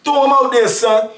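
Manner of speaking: quick rallying shout, imitated; mock holler. Inmate calling the block to throw trays, mock yell. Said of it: "want everybody get behind him".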